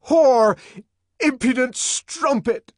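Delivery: high, loud raging insult; furious shout. A narrator's reading. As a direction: spit the words at her in fury. John raging.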